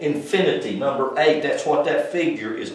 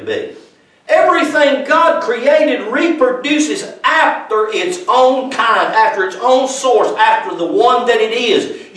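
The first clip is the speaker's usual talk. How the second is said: high and loud, preaching hard to the room; full-force preaching; 9 semitones higher, 6 dB louder than usual. Acted. Preacher rousing his congregation, full-force preaching. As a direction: drive every line home from the pulpit.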